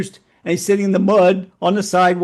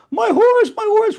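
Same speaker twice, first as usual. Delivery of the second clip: high, hammed-up wailing; moaning wail; 5 dB louder than usual. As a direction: imitate a villager wailing over his loss. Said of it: "continued to moan and wail".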